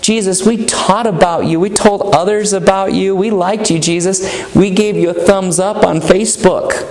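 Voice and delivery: high, pleading voice of a self-assured believer; pleading churchgoer. Retold or imitated. Claimants pleading with Jesus, voiced.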